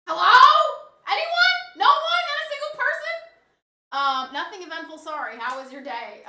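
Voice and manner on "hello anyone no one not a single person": high, loud calls into the empty chat; teasing singsong calls to a silent chat; mock calling out. Real, not acted. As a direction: call out playfully, high and loud, to an empty room.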